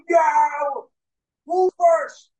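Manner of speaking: loud, high acted shout; crowd shout and challenge. Crowd roars, daredevil asks for volunteer.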